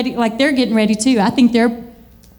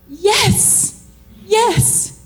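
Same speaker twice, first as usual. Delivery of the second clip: excited yes; 7 semitones higher than usual. Retold or imitated. Excited reply.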